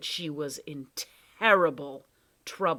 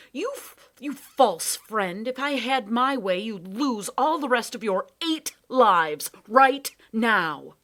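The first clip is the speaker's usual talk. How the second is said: furious; 5 semitones higher, 8 dB louder than usual. Acted. Betrayed Lindy rages at the cat, furious. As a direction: spit out every word. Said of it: "she snapped".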